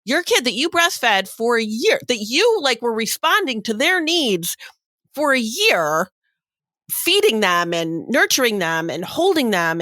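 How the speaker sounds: emphatic lecture. Retold or imitated. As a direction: press each point home like a lecture. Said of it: "And I'm like".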